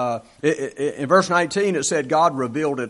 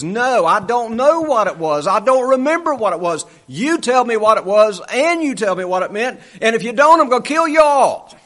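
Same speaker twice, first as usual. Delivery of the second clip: building furious shout, higher and louder, ending in a yell; angry king; 6 semitones higher, 5 dB louder than usual. Retold or imitated. The preacher voicing the king who threatens his wise men, angry shout. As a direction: build from stern to a roaring threat of death. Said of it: "gets angry and says".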